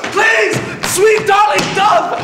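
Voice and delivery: loud, very high begging cry; desperate shouted plea. Acted. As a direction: shout after someone walking out on you. Supervisor cries out after her.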